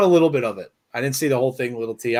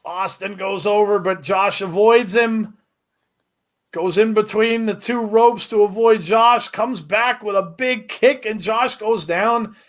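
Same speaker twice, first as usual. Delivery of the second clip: rising excited play-by-play, higher; wrestling commentator; 8 semitones higher, slower than usual. Acted. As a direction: call the match live, building hype. Live play-by-play of the match.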